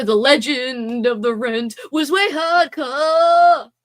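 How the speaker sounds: slow, loud hammed-up rocker. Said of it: "School of Rock is a family favorite".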